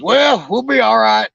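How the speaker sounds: put-on drawn out high, unbothered like the old buddy; buddy's voice. Buddy voiced in boat story.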